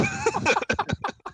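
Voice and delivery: helpless giggle, squeaky; high squealing laugh. Real, not acted. Caught off guard by a face.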